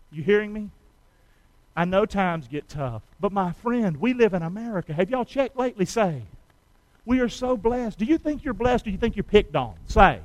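ordinary talk

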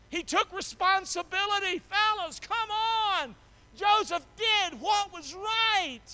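high, loud, slow and drawn-out; full-force preaching. Real, not acted. Pastor urging the fellas in a high, loud preaching cry. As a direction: hammer every phrase home.

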